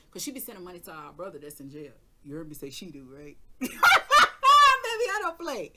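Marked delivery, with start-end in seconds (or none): 3.6-5.7 squealed high and loud through a laugh. Real, not acted. To the viewers, a laughing high shriek. Insisting she doesn't play around.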